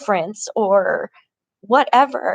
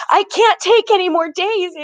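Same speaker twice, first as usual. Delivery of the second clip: high, strained retelling of her own upset plea; pleading loudly, close to breaking; upset protest; 10 semitones higher, 3 dB louder than usual. Retold or imitated. Telling her friend she can't take more days, upset and high. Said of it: "And I got even up more upset".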